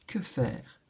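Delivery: soft, low worried question; worried murmur. Acted. La souris seule avec la lettre, inquiète et basse. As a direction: ask it softly, at a loss.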